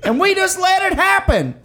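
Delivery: slow build into a high, hammed-up shout; mock outraged rant. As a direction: rail like a truck-video ranter, climbing to a yell. Mock truck rant about inequality.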